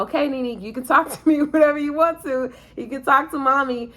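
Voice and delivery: reassuring mom. Retold or imitated. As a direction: coax her to open up, lovingly.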